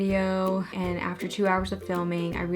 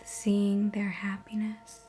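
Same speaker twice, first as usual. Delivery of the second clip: soft, measured meditation voice with long pauses; guided meditation; 5 dB quieter than usual. Acted. Host guiding viewers through a relaxation visualization, guided meditation. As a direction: ease listeners into calm, phrase by phrase.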